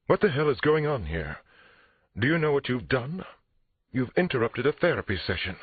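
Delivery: high-pitched indignant outrage; frightened and angry. A narrator's reading. Therapist whose session was interrupted, angrily demanding. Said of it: "She looked frightened and angry".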